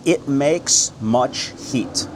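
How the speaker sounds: very slow, each word set apart; slow, emphatic word-by-word delivery. Shown as a demonstration. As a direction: spell out the literal translation slowly. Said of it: "word for word".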